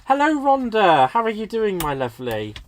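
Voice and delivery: big hello that settles down by the last words; effusive greeting. Real, not acted. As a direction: sing out a welcome to a friend. Greeting a viewer who joined.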